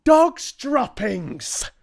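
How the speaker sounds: very high, loud, drawn-out outcry; group shout. A narrator's reading. Whole assembly takes up the dreaded cry, high shout. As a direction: shout it slowly in a high squeal. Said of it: "they shouted".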